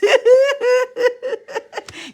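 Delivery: shrieking giggle, way up high; loud high-pitched cackle; high squealing laugh. Real, not acted. Laugh continues.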